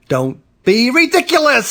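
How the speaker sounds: high, incredulous brush-off; indignant official, pitched high; dismissive scolding. A narrator's reading. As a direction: scoff at a silly report. Police dismiss the emergency call.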